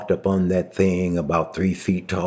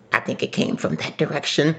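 put-on high old woman voice; 8 semitones higher, 3 dB quieter, faster than usual.

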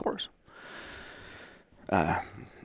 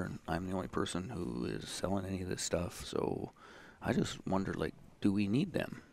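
quietly quoted, soft hesitant aside; confiding salesman; 7 dB quieter than usual. Retold or imitated. Salesman privately sounding out young colleague, confiding.

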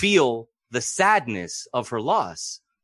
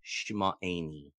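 repeated key word; 8 semitones lower, 11 dB quieter than usual. Shown as a demonstration. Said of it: "one word that keeps coming up".

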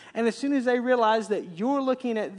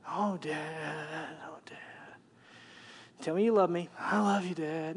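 daughter's voice; 5 semitones lower, 7 dB quieter than usual. Retold or imitated. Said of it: "I make sure on a regular basis".

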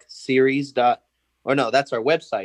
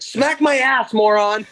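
high, loud mock bellow; imitating Thad Castle; 11 semitones higher, 6 dB louder, slower than usual. Quarterback demanding a slap from the backup, mock shout. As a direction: blast it like an outraged jock. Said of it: "He's like".

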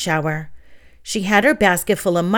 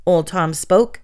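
plain reading voice

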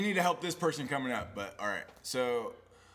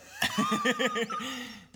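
very high laughing fit; squealing laugh at own joke. Real, not acted.

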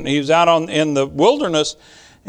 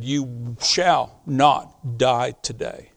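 slow, very soft, lower command; whispered in her ear; 6 semitones lower, 5 dB quieter, much slower than usual. Retold or imitated. Husband leaning over his dying wife, slow soft whisper. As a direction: breathe each word into her ear, slowly. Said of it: "whispered in her ear".

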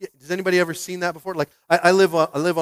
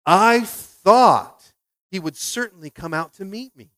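slow, louder, higher offended complaint; indignant Naaman; 4 semitones higher, 7 dB louder, slower than usual. Acted. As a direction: stress each word of the grievance.

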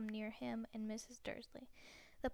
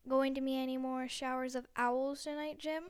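louder, jokey newsreader question; jovial anchor; 6 dB louder than usual. Acted. News anchor joking to the weatherman, jovial. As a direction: tease the weatherman cheerfully.

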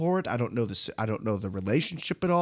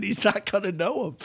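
laughing; 7 semitones higher than usual. Real, not acted. Laughing while re-quoting.

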